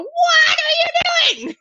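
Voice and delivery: high, loud, drawn-out mock shriek; retold astonishment. People finding out about the full-time job, astonished. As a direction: squawk it in disbelief, half laughing. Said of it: "when they found that out, they were like".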